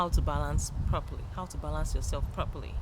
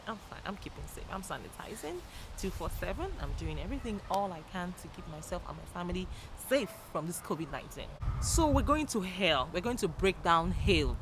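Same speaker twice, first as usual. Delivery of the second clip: usual speaking voice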